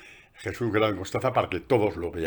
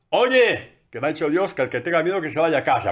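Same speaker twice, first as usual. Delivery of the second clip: calling out; 6 semitones higher, 6 dB louder than usual. Retold or imitated. Gideon calls out to troops.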